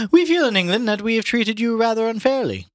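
high English gentleman; Haynes voice. A narrator's reading. Reader voicing Haynes as an Englishman, high. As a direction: lift into the Englishman. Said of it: "Remember this line".

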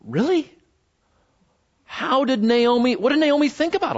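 high-pitched incredulous questioning; baffled reader. Acted. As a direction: ask it in puzzled disbelief, voice way up. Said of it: "And you go".

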